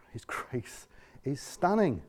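slow, soft and tender; soft reverent refrain; hushed refrain. Real, not acted. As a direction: linger on stunning quietly. Same refrain again.

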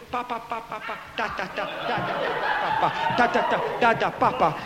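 low, halting "pa-pa, ta-ta" babble; mock tongues in short, low bursts; mimicked stuttering syllables. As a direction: imitate feeble, sputtering tongues. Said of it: "and they go".

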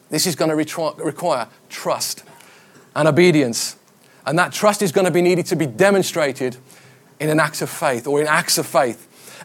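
ordinary talk, nothing marked

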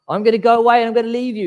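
high, loud and fast; animated paraphrase. Retold or imitated. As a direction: rattle it off brightly. Paraphrasing Jesus lively.

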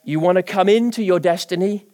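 usual speaking voice

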